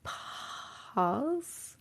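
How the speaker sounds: soft, drawn-out "pahz" for "pause"; hushed, questioning "pahz" for "pause"; attempted foreign-feeling pronunciation. Shown as a demonstration. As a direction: tentatively say "pause" with open "ah", rising. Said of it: "That's hard for me to say".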